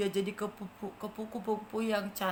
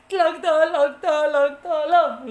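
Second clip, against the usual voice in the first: louder repeated tolong; loud calls for help; 3 semitones higher, 12 dB louder, slower than usual. Acted. Ant keeps calling for rescue loudly. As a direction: call out for help again. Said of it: "kata semutnya".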